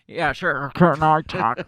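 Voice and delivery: loud thumps right into the mic; tapping the mic. Retold or imitated. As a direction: talk with the mic jammed close, tapping it. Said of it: "stop tapping on the microphone".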